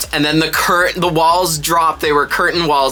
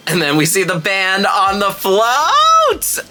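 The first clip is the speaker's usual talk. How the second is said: excited; 8 semitones higher, 4 dB louder than usual. Real, not acted. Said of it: "I get goosebumps when I see that".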